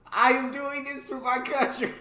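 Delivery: high, loud hammed-up cry; triumphant mock cry. New Zealander shoving the Australian out, mock heroic cry. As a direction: belt it out high, on the edge of laughter. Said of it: "and cried".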